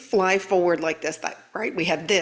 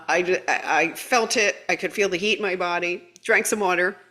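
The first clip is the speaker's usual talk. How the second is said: normal talking voice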